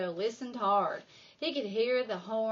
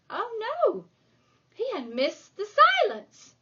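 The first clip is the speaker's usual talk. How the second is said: high, louder oh no of alarm; dismayed surprise; 11 semitones higher, 7 dB louder than usual. Acted. Narrator voices Yoshio's dismay, alarmed. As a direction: gasp it out, caught off guard.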